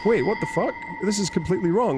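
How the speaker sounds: mock shock at the wrong answer; disbelief. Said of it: "you're like".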